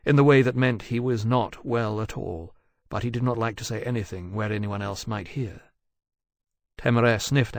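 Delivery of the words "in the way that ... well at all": usual speaking voice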